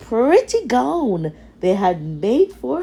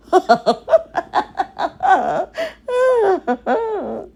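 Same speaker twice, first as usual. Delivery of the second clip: higher, cruel cackling laugh; cruel laughter; 10 semitones higher than usual. Acted. Stepsisters laugh cruelly leaving Cinderella behind, a higher cackle. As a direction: laugh cruelly as you leave. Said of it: "Laughing cruelly".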